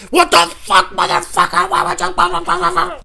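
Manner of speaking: loud, very high furious screaming; road rage yell. Retold or imitated. Driver yelling at someone who cut him off, loud and furious. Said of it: "I'd be like".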